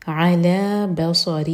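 thick ص in بصرہ, drawn out; heavy saad. Shown as a demonstration. Teacher modelling the heavy saad for students, slow. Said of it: "صاد heavy".

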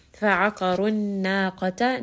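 Fa'aqarun naqata slowly recited, qaf and ra full and heavy; heavy letters recited. Shown as a demonstration. Teacher showing heavy letters to students, slow recitation. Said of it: "qaf is going to be heavy, ra is going to be heavy".

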